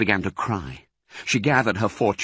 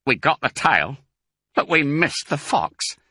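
lightly voiced disappointed farmer, higher pitch; farmer Bean; 7 semitones higher than usual. A narrator's reading. Bean tossing away the tail, mildly frustrated farmer. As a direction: give Bean a touch of character. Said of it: "he said, tossing the thing away".

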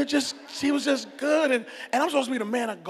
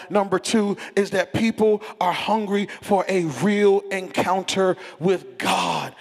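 ordinary talk

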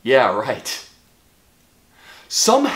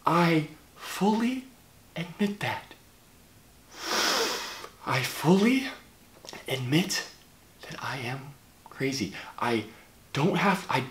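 slow, soft, whispered confession; hushed admission; 7 semitones lower, 11 dB quieter than usual. Acted. Confessing to viewers he is crazy, hushed. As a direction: let it sink down to a whisper.

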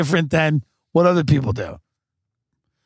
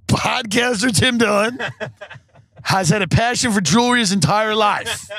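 higher, salesy infomercial voice; mock ad read; 6 semitones higher than usual. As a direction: sell it like a cheesy commercial.

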